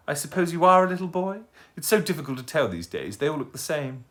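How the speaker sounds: put-on a dotty, courteous old lady; polite old lady. Old lady greeting the owl who fell at her feet, genteel and high.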